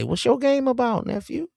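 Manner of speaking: high sarcastic question; mocking taunt. Host mocks a young gamer man, high and teasing. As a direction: tease him with a high sarcastic challenge.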